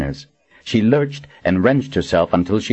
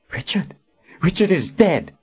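higher, strained answer under interrogation; pained outcry; 11 semitones higher than usual. Acted. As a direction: answer the captor in a raised pitch.

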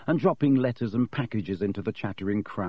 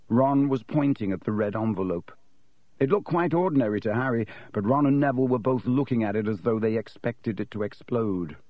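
plain reading voice

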